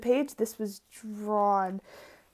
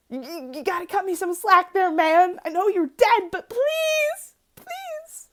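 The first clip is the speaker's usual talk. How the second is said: higher, rising into a crying plea; mock pleading; 8 semitones higher, 6 dB louder than usual. Begging [name] to cut her slack, mock tearful plea. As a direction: beg a dead idol through fake tears. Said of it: "There's something about him where I'm like".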